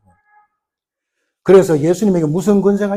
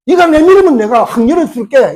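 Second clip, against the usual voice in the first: loud raised promise; God's promise; 7 semitones higher, 6 dB louder than usual. Acted. God promising to open the sea, in a loud confident voice. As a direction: promise it with power.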